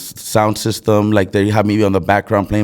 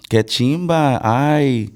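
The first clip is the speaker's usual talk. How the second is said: higher, singsong Colombian Spanish; Colombian accent; 3 semitones higher than usual. Shown as a demonstration. Showing friends a Colombian sample, Colombian accent. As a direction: put on a Medellín paisa accent.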